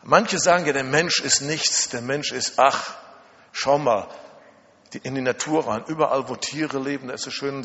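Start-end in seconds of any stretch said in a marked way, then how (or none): none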